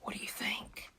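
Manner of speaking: very soft, unvoiced whisper; whispered aside. Storyteller asking listeners about proof, whispered.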